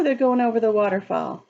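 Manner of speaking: usual speaking voice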